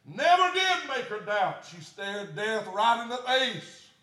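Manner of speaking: loud, high preaching; whooping sermon cry; full-force preaching. Acted. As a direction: preach it with fire.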